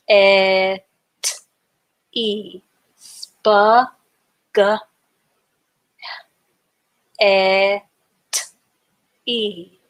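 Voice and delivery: "spa, g, et, e" said apart with pauses; sounding out syllables. Shown as a demonstration. Teacher breaking spaghetti into syllables for kids. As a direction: split the word into slow separate chunks. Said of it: "take the time and break the word up into syllables".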